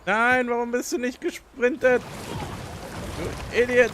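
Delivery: high furious yell at the screen; angry shout. Real, not acted. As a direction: blow up at a dumb teammate. Yells at his game character. (wütend schreien)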